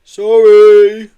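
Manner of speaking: high, drawn-out, loud apology; sheepish big-creature sorry; guilty yeti. A narrator's reading. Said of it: "mumbled a guilty Marbo".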